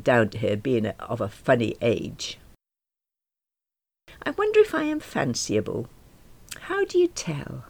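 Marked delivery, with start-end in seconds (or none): 4.2-7.7 wistful musing. A narrator's reading. Inner musing, higher.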